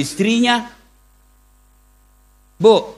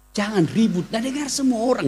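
ordinary talk

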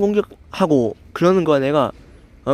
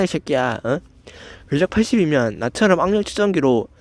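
ordinary talk

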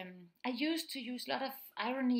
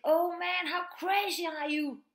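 loud, high exclaiming; slangy mock exclamation; 6 semitones higher, 6 dB louder than usual. Parent exclaiming slang at kids, loud mock amazement. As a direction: blurt it loudly in mock amazement. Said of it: "came home and used the slang".

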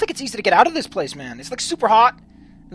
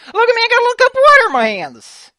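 much higher, giddy delight; excited; 8 dB louder than usual. Acted. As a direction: squeal it like a proud child. Excited at holding water.

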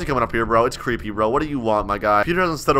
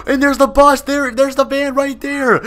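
gasping discovery; 9 semitones higher than usual. Real, not acted. Spots the van on camera.

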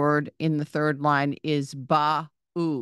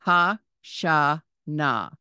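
Hashana drawn out slowly, ha-sha-na; slow careful reading; much slower than usual. Shown as a demonstration. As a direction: spell Hashana out syllable by syllable. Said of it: "Hashana with the ha at the beginning".

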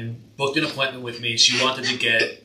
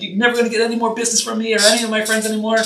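higher, louder mock threat from the client; angry client; 10 semitones higher, 6 dB louder, faster than usual. Tattoo artist mimics an angry client threatening Yelp, higher and louder. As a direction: play the huffy client threatening a bad review.

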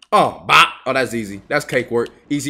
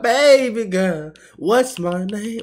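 slow flirty voice; 5 semitones higher, much slower than usual. Retold or imitated. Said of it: "I was like".

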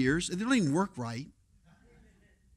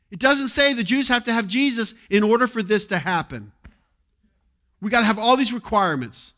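louder, higher fiery preaching; full-force preaching; 4 semitones higher, 8 dB louder than usual. Acted. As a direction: preach it hard at the congregation.

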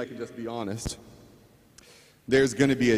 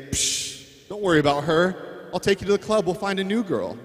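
higher, dismissive party bro; lightly voiced club-going buddy; bad-influence buddy; 6 semitones higher than usual. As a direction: brush it off like a careless friend. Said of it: "guy friends that are gonna say".

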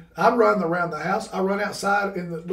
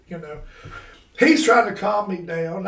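ordinary talk, nothing marked

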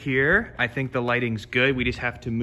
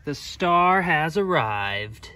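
announcer voice; 4 semitones higher, much slower than usual. Real, not acted. Announcing the dog.

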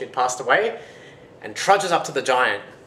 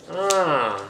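knowing ah; 7 semitones higher, 4 dB louder than usual. Acted. Giant catches on.

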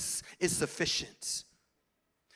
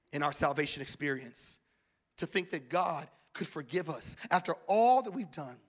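ordinary talk, nothing marked

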